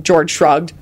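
ordinary delivery, nothing marked

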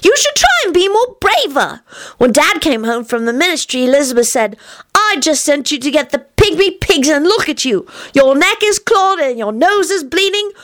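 put-on high little-kid voice telling tales; high, singsong child's report; pious child Teddy. A narrator's reading. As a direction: recount it eagerly like a small child. Teddy retells parents' quarrel.

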